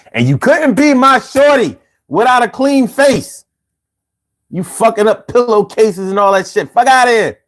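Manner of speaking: cocky bravado. Retold or imitated. Young self boasting.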